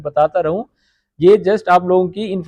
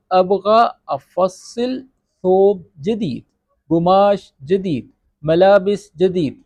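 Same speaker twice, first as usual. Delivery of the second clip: slow, careful ثوب جدید, قماش جدید, ملابس جدید; teacher dictating choices; slow word options; much slower than usual. Shown as a demonstration. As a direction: list each option slowly. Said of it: "کچھ بھی لگا دیں".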